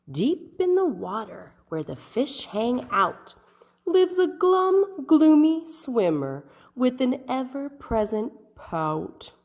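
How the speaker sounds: put-on lively read-aloud for little kids; expressive picture-book reading; storybook narration. A narrator's reading. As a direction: paint the underwater scene for children.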